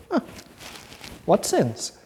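lightly voiced Igor, shrugging off the sins; a touch of Igor playing dumb. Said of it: "like Igor".

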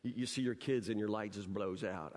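usual speaking voice

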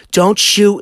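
high, loud imitated yell; angry New Yorker. Man imitates wife turning on her husband, angry yell. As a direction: mimic a furious New York wife. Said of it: "she's a New Yorker, remember that".

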